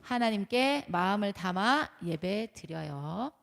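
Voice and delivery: slow, loud group reading; leading a read-aloud. Shown as a demonstration. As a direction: read it out slowly for all to join. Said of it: "같이 시작". (다 같이 또박또박 크게 읽기)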